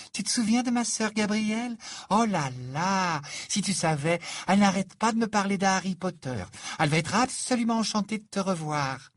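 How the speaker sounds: high, excited, effusive chatter; high, exclamatory Fleur voice; gushing young Frenchwoman. Acted. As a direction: gush with delight to an old friend. Fleur's character voice.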